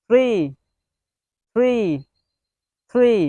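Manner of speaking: "three" with the tongue tip between the teeth. Shown as a demonstration. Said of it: "জিহ্বার আগা থেকে উচ্চারণ করবেন".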